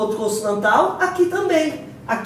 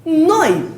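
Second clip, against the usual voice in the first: "Noi" high, falling, stress on the o; correct diphthong; 5 semitones higher, 4 dB louder than usual. Shown as a demonstration. Teacher confirming the answer to the class, correct diphthong. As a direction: punch the o in "Noi", let the i fall away. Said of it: "Não é noite? Noi".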